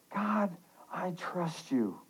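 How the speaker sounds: soft, slow declaration of trust; quiet prayer. Acted. Preacher showing the congregation how to pray, soft and slow. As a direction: say it slowly and softly, as if to God.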